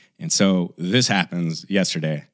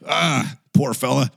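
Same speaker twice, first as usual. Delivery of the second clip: dad's pity; 6 semitones higher than usual. Retold or imitated. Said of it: "my dad just goes".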